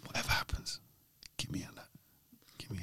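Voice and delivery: hushed, nearly whispered plea; urgent word to agent. Retold or imitated. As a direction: drop to a whisper, as if confiding to your agent. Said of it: "So I just told my agent".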